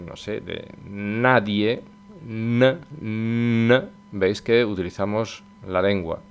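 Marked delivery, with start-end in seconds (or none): 2.2-3.8 tongue-tip n, held; Spanish N. Shown as a demonstration. Teacher demoing the Spanish tongue N. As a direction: say n with the tongue on the ridge. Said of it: "Intentar pronunciar la N".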